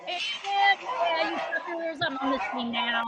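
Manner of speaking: gloating dog voice. Acted. Voicing dog that switched sides.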